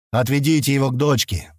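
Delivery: ordinary delivery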